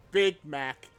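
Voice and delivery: "Big Mac" said stiff and foreign, each word flat and separate; stilted English. Shown as a demonstration. Streamer demoing the joke line in stilted English. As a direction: give "Big Mac" a clunky foreign accent. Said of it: "like, stilted English".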